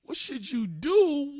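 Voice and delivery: slow, loud and high disbelief; incredulous. Retold or imitated. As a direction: drag it out, can't believe she asked. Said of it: "I'm like".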